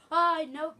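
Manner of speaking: very loud, high burst; alarmed yelp. Real, not acted. Player spotting an enemy on the ladder, alarmed yelp. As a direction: shout a sudden refusal in alarm.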